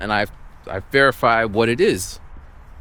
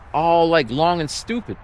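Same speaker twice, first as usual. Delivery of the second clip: normal talking voice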